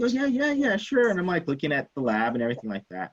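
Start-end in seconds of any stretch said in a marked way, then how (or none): 0.0-1.1 high-pitched, breezy imitation of a buddy agreeing; eager agreement. Buddy agrees to show his lab, high and eager. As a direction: mimic a readily obliging pal, voice pitched up.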